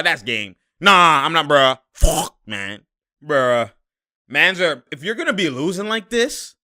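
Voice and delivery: ordinary talk, nothing marked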